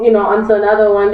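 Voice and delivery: ordinary delivery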